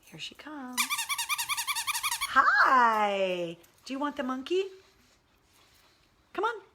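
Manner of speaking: high, sing-song coaxing of a pet. Real, not acted. Owner calling her pug to come get the monkey toy, high coaxing baby talk. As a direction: coax the sleepy pug over with a toy.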